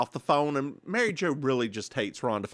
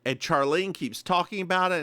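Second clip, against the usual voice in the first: normal talking voice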